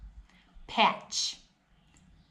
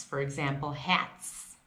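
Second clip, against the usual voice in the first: ordinary delivery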